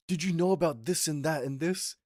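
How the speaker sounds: imitating shouting brother. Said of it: "he was shouting at my mom".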